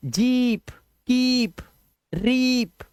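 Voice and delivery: जीप, कीप, रीप with a high, held ee; long vowel. Shown as a demonstration. Teacher showing students how to read, long ee in जीप, कीप, रीप. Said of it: "ऐसे".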